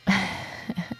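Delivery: quietly amused little laugh; teasing chuckle. Acted. Teasing the coworker.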